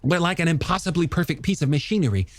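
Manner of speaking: high, animated, rhapsodic character voice; passionate young scientist. Acted. Scientist defending sharks to a doubter, high impassioned voice. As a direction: rush eagerly through the wonders, pitched well up.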